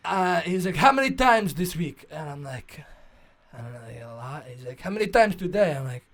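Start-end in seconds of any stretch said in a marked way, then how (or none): 0.8-2.1 put-on loud, high Russian-accented doctor; imitated Russian accent, booming question. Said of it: "like, Russian accent". 3.5-4.5 low, quiet, embarrassed reply; softly mumbled, mock embarrassment; sheepish answer. Embarrassed answer to the loud doctor. 4.8-5.8 put-on loud, higher Russian-accented doctor; imitated Russian accent, loud question. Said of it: "like, Russian accent".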